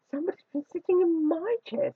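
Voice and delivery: high, middle-sized bear voice; mellow Mother Bear. A narrator's reading. Mother Bear finding her chair sat in, mellow. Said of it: "said Mother Bear in a mellow".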